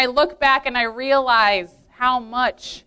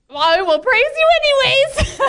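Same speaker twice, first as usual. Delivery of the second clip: much higher, laughing and louder; laughing resigned prayer; 11 semitones higher, 4 dB louder than usual. Retold or imitated. Telling God she'll praise him anyway, laughing.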